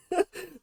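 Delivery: short high giggle; a burst of high laughter. Real, not acted. Amused by Maryland, a high giggle. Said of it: "It's hysterical to me".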